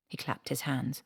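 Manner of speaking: ordinary talk, nothing marked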